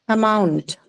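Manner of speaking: amount with MOUNT stressed, falling; light a, weight on MOUNT in amount; stress on second syllable. Shown as a demonstration. Teacher introduces amount to the class, stressing MOUNT. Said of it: "mark it on the second syllable".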